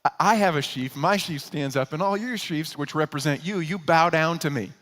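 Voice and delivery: put-on a gushing kid bragging to his brothers about his dream; boastful naive brother. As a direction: show off your dream like a proud little brother. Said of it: "Instead, he goes".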